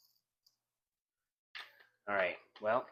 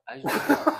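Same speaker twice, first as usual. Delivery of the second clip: higher, louder, pleading shout; loud plea; 12 dB louder, faster than usual. Acted. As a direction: yell it out, begging to help.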